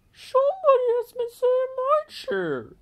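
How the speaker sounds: very high, squeaky mother bear voice; shrill up in the head as the middle bear; middle-sized bear voice. A narrator's reading. Middle bear finds her porridge tasted, very high voice. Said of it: "said the middle-sized bear in her middle-sized voice".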